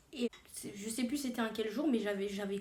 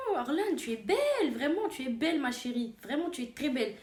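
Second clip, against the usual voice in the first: mother's insincere flattery; 3 semitones higher than usual. Retold or imitated. Mother avoiding paying again, mock gushing praise. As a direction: imitate a mother overdoing the compliments. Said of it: "vu qu'elle veut pas ressortir l'argent".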